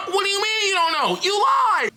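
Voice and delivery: high, laughing call-out; mock self-scolding. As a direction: playfully shriek an accusation at yourself. Playfully scolding their past self.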